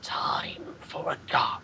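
slow, low whispered villain line; menacing villain whisper. Retold or imitated. Mocking the Horned King as a Care Bear villain, slow menacing whisper. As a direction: hiss it like a gloating cartoon villain. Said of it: "see the Horned King just go out going".

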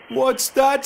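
high, bright river spirit. Acted. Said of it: "said the river spirit".

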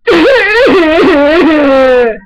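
loud wheezy laugh that sounds like crying; stupid laugh. Shown as a demonstration. Kid trying the stupidest laugh ever, cough-like cackle. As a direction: force out a silly hacking laugh. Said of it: "make the stupidest laugh ever in history".

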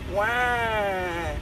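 long drawn-out, soft pretend wail; mock baby cry. Man voicing his crying baby, mock wail. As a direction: imitate a baby's wah. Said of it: "My baby crying".

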